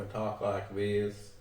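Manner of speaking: slow Southern drawl; Southern accent. Shown as a demonstration. Demonstrating Texan speech to the interviewer, slow Southern accent. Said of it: "Texas is more down in the south".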